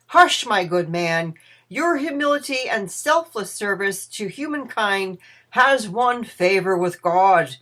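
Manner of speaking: loud, emphatic saintly address; emphatic pronouncement. A narrator's reading. Bede hushes humble Ebenezer and praises his service, emphatic pronouncement. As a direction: hush him, then proclaim his virtues firmly.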